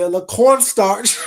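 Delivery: high, loud, laughing through the words; loud mocking laugh over cornstarch; mocking laughter. Real, not acted. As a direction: cackle while mocking her. Mocking remedies while laughing.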